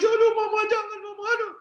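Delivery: a mock high yelp of pain from a child; wailing child. Child being beaten cries out to his mother, mock wail. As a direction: imitate a kid crying out under the broom. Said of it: "Mama nangis".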